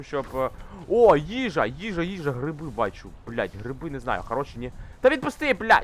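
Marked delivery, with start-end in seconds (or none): 5.0-5.8 frustrated yell. Real, not acted. Yelling at stuck game character.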